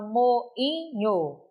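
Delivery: "moinho" split into mo-i-nho; syllables separated. Shown as a demonstration. Teacher showing the same hiatus, syllables separated. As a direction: say "moinho" in three syllables, the I standing alone. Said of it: "Esse I é um hiato".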